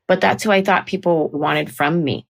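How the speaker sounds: ordinary delivery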